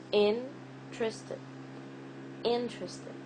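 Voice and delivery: INterested, slow IN with a stop after it; overpronounced first syllable. Shown as a demonstration. Teacher demonstrates what stress means, overpronounced IN in interested. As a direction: overpronounce the IN of interested, then let the rest fall. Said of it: "the stress means that you overpronounce, you stop".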